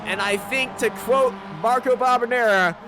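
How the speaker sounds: rising, frantic play-by-play shout; excited race call. Real, not acted. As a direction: call the finish at full voice.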